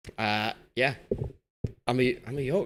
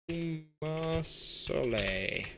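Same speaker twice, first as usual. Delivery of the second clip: "Umar Soleil" very slow and higher; drawn-out name; 5 semitones higher, 4 dB quieter, much slower than usual. Real, not acted. Streamer repeating a player's name, "Umar Soleil" drawn out slowly. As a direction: stretch out every syllable of "Umar Soleil".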